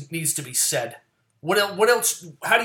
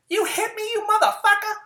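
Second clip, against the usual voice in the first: a much higher, shrieking pretend yell; mock furious scream; 8 dB louder than usual. The pinned woman screaming at her attacker, a high furious shriek.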